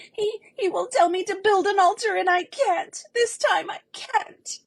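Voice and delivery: high, loud shuddering cry; terrified girl trembling; shuddering terror. Acted. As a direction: shudder through chattering teeth. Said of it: "shuddered Much Afraid through chattering teeth".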